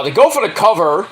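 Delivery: ordinary delivery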